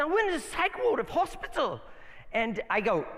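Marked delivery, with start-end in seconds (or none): 0.0-1.8 Afrikaans-British imitation, hospital without the article, higher; Afrikaans British accent. Pastor correcting the quote to the friend's Afrikaans-British phrasing. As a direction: drop "the" before "hospital" the way the friend does. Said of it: "they say hospital".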